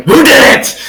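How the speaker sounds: high, loud frantic question; high-pitched frantic shout; frantic demand. Acted. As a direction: demand an answer wildly. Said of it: "I'm frantically looking around".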